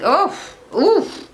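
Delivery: rising oof of disgust. Real, not acted. Viewer reacting to an ugly hairstyle, rising oofs. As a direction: wince at the hairstyle.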